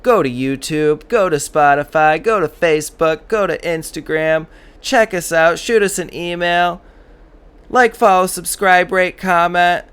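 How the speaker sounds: high, loud hyped radio plug. Pretend. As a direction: pitch it like a pushy radio ad. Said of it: "I'm gonna work on my radio voice".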